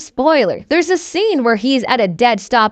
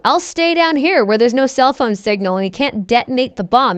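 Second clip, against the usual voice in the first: ordinary delivery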